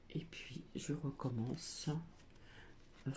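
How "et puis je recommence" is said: unvoiced whispered aside. Real, not acted. Reader alone at her table, whispered aside. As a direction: breathe it under your breath to yourself. Aside while shuffling cards.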